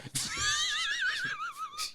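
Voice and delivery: very high shrieking laughter. Real, not acted. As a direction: squeal with laughter. Reacting to awful accent.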